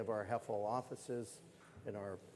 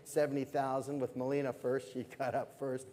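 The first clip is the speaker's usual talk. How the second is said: brisk, lively auctioneer calling bids; fine art auctioneer; 3 semitones higher, 4 dB louder than usual. Acted. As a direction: keep the bids rolling like a gala auction.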